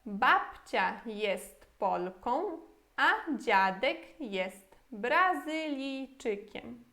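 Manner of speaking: slow, every Polish word spelled out for learners; slow clear model sentence. Shown as a demonstration. Teacher modelling the full Polish sentence for students, slow and clear. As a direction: read the whole sentence slowly like a teacher. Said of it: "E a frase toda em polonês?".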